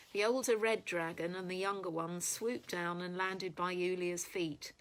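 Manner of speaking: plain reading voice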